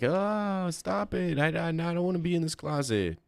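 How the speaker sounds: high mock whiny complaining voice; whiny woman imitation. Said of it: "They always start complaining, like".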